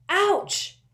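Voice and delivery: high loud yelp of pain; bellow. A narrator's reading. Malfoy pricked by a pin, loud high yelp. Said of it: "bellowed Malfoy".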